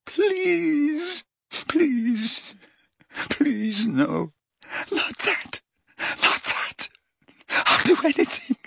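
high pleas sinking to a whisper; terrified whispered pleading. A narrator's reading. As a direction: beg in breathless terror. Dumbledore begging in terror.

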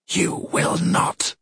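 slow, whispery, icy command; cold, knife-sharp. A narrator's reading. Goddess silences the screaming princess, cold and knife-sharp. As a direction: cut through the shouting with slow, cold words. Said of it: "The words were cold and knife-sharp".

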